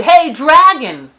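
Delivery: very loud, high, slow shout; shouting as loud as she can. Acted. Princess yelling into the dragon's ear, full shout. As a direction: yell right into his ear. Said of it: "She shouted as loud as she could".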